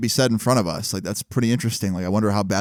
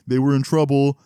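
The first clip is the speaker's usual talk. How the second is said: taunting classmates; 4 semitones higher than usual. Retold or imitated. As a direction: tease like a roomful of schoolkids. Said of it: "the whole class looked at us with".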